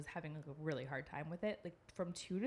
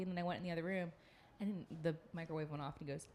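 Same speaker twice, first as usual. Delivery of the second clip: ordinary talk, nothing marked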